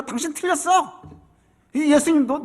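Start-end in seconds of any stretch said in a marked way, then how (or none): 0.0-1.0 heated retort. Acted. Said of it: "막 막욕을 하고 있는 거죠".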